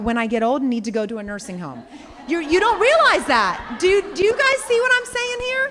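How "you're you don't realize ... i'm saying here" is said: high, loud, insistent call to the room; emphatic shout. Real, not acted. Coach shouting her point to the class, emphatic and loud. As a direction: really drive the point home, loud.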